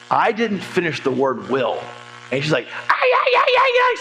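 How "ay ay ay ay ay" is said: sudden very high, loud frustrated shriek; mock angry shriek. Client's shriek at the coach before hanging up, mock furious. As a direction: screech it out like she's losing it. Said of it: "said words you can't say in church".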